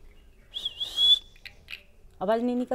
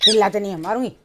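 much louder, scolding threat to the bird; mock threat; 3 semitones lower, 8 dB louder, much faster than usual. Real, not acted. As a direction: threaten the parrot, half joking. Threatening the screeching parrot. (तोते को ज़ोर से धमकाना)

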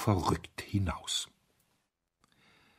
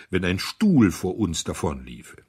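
ordinary delivery

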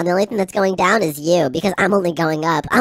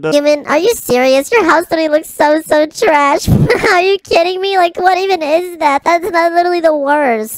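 high, sneering taunt at the human; mocking rival; 8 semitones higher than usual. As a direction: tease him like a snooty rival.

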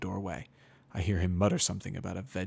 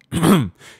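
guard clears his throat loudly; gruff loud throat clear; 8 semitones higher, 16 dB louder than usual. A narrator's reading. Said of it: "clearing his throat".

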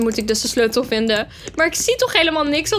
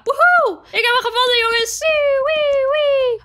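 cheering; 11 semitones higher than usual. Real, not acted. Key found, she whoops to her viewers in victory. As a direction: whoop like you just won.

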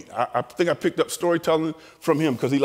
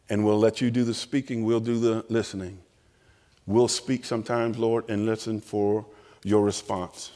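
closing prayer; 5 semitones lower than usual. Real, not acted. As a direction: lead the room in prayer.

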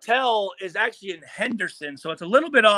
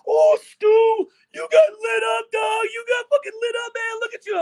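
much higher, loud jeering sideline heckle; mocking taunt; 4 dB louder than usual. Phillip Rivers taunting Stu after he got hit on kickoff, high jeering shout. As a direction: crow at him like a cocky QB laughing from the sideline. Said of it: "Phillip Rivers was on the side like".

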